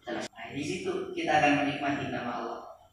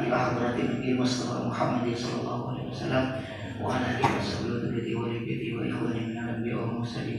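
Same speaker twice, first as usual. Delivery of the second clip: quick, running prayer recitation; fluent, level-toned devotional recitation; Arabic dedication prayer; 3 semitones lower than usual. Real, not acted. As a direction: recite the opening prayer in one steady stream. Opens joint dhikr with dedication prayer.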